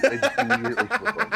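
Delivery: high, loud, laughing. Acted. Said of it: "repeated in a laughing high voice".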